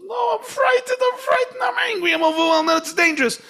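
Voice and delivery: very high, loud, crying shriek of fear; frightened inner animal screaming in panic; terrified animal soul. Retold or imitated. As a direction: wail like a panicked child mid-tantrum. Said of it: "the animal soul says".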